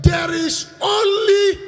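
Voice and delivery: high, loud and slow; call to congregation. Shown as a demonstration. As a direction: shout it for the church to repeat. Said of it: "everybody say it louder".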